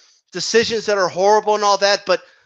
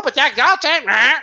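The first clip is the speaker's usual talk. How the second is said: higher squawky parrot repeating Jack Johnson; 3 semitones higher than usual. Retold or imitated. Mocking people who repeat claims, parrot voice. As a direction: parrot the name mindlessly. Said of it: "a bunch of fucking parrots".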